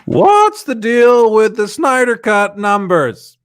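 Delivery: high, loud, drawn-out comic impression; Jerry Seinfeld. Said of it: "That is my Jerry Seinfeld impression".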